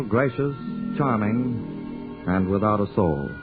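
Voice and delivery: ordinary talk, nothing marked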